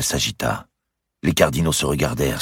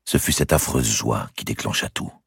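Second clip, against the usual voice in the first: ordinary delivery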